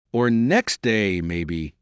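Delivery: very slow, deadpan footman; same solemn tone. Acted. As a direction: drag it out, unbothered. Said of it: "in the same tone".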